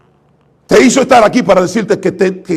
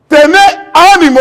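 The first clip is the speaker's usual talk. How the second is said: higher, louder, slow proclamation; proclaimed loudly; 9 semitones higher, 7 dB louder, much slower than usual. Shown as a demonstration. Jesus calling to frightened disciples, loud slow proclamation. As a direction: call it out over the storm, drawn out. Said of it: "le dice el Señor a esta gente que están gritando".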